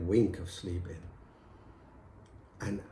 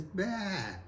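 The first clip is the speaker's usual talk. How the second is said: mock goat bleat, higher; imitating a bleat; 8 semitones higher, 5 dB quieter than usual. Husband showing priest what wife says, bleating. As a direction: bleat it like she does. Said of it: "All she says is".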